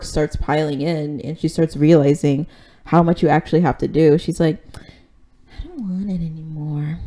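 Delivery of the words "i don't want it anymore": softer put-on reluctant whine; mock whining. President realizes how much work it is, mock whine. Acted overwhelmed president giving up.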